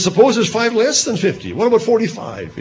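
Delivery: high, coaxing bargain; Abraham bargaining. Acted. Abraham haggling with God over the city, in a high coaxing voice. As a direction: nudge God down gently.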